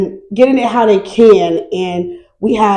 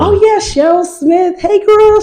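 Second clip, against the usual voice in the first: much higher, gleeful name-drop; excited shout-out; 11 semitones higher than usual. Real, not acted. Shout-out to her absent partner.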